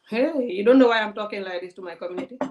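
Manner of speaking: usual speaking voice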